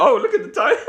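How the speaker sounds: high, put-on nonchalant imitation; mock-casual quote. Imagined worker walking up to the boss, mock-casual. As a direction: mimic it on the edge of laughter. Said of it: "imagine walking up to your boss".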